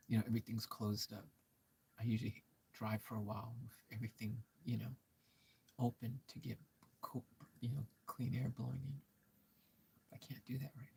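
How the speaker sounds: hushed, nearly whispered words; whispering. Real, not acted. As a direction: keep it down so nobody hears. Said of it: "I don't know why I'm whispering".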